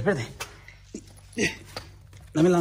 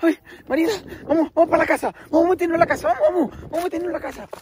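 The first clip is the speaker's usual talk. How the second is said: being chased; 13 semitones higher than usual. Pretend. Daughter spots the clown, they flee.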